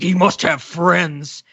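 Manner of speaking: imitating Batman, slow; Batman growl. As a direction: drag it out like Batman. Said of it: "he's like".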